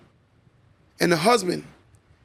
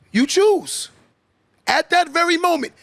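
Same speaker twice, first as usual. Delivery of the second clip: preaching shout; 9 semitones higher than usual. Real, not acted. Speaker challenges men at the sermon's peak, shouting. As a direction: lift it up like a preacher.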